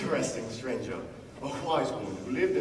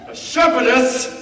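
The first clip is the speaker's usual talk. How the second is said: exasperated; 9 semitones higher, 12 dB louder than usual. Acted. Narrator repeating cue, exasperated.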